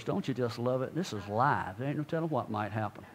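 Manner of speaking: low, soft, throwaway remark; muttered aside. Real, not acted. Preacher joking that this is live, muttered aside. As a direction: drop the voice well down, as a side comment.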